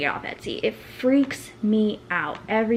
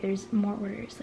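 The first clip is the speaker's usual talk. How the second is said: ordinary talk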